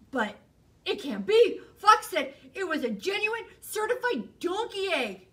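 high, loud pleading protest; insist, refusing to believe it; disbelieving protest. Acted. Bear protesting to Hare that Fox promised an egg.

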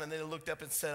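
ordinary delivery

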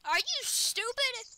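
slow, high, loud and emphatic; exasperated disbelief. Real, not acted. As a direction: drag the question out loudly in disbelief. Repeats the question after friend's no.

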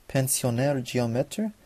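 "Pensionnaire Géomètre" said slowly with French vowels; attempted French pronunciation. Shown as a demonstration. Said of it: "not really sure how to pronounce that".

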